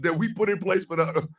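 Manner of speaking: usual speaking voice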